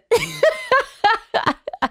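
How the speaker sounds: high, bubbling laugh at her own joke; real burst of laughter. Real, not acted. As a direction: crack up laughing. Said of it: "Oh my goodness".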